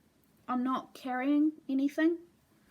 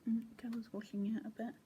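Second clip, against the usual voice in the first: soft, quick whispered aside; 10 dB quieter, faster than usual. Real, not acted. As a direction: murmur it under your breath while folding the fabric. Quiet aside while handling fabric.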